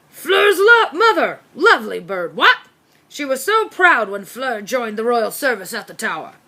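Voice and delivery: put-on blustering old officer, jovial; hearty colonel who barks his questions; pompous military dog. Corgi Brigadier reassuring Byrne about his height, blustering.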